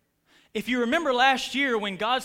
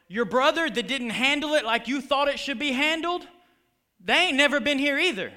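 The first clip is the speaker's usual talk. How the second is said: fired-up preacher, loud and high; preaching; 4 semitones higher, 5 dB louder than usual. Acted. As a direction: preach it with full force. Preaching at full force.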